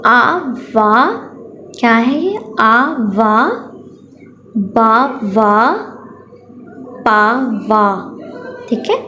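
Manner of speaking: slow syllable-by-syllable reading aloud; teacher sounding out letters; phonics reading drill. Shown as a demonstration. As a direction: sound out each syllable with long pauses for children. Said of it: "ये मैं आपको reading करवा देती हूँ".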